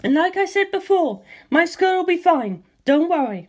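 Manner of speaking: high, bright-voiced young woman, confident; high female character voice, calm and sure; Lisa Lisa's voice. A narrator's reading. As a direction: lift into her high voice and reassure.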